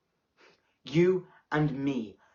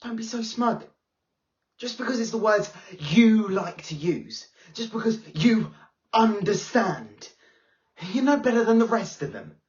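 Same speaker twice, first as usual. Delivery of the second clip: higher, rising, then fast and accusing; angry confrontation; 5 semitones higher, 4 dB louder than usual. Acted. Pupil confronts smug teacher, angry and fast. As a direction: throw it back at them, fed up.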